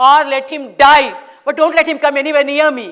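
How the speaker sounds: loud, fast angry outburst; ranting. Acted. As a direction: rant it, no restraint. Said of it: "All this ranting".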